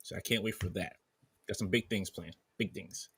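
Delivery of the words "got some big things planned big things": bad Peter Griffin impression; lightly voiced Peter Griffin. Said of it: "I sound like bad Peter Griffin".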